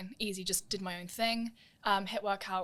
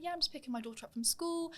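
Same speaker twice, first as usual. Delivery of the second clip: lightly voiced agent, higher and breezy; casual agent voice; 8 semitones higher, 4 dB quieter than usual. Agent chatting about school pickup, light higher voice. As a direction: imitate the agent's easy small talk, pitched up. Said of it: "He was like".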